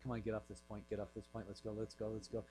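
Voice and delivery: low, hushed repeated urging; nervous muttering. Retold or imitated. Nervous listener wishing the preacher would move on, muttered. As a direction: mutter it like an anxious private thought.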